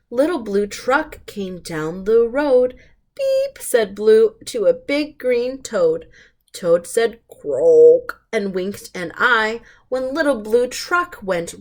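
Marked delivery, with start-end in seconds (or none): 3.1-3.6 a squeaky toy-truck beep, way up high; high horn beep. Acted. Truck's horn in a picture book. 7.3-8.2 a low, loud froggy croak; low toad croak. Acted.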